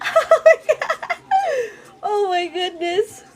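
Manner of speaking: high, slow, laughing squeal; overwhelmed joy. Pretend. Imagines hearing it's a boy.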